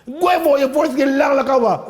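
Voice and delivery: loud mocking patois jeer; a patois jab at a giant; Jamaican patois taunt. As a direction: taunt him in broad patois. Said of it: "what he really said in Jamaican".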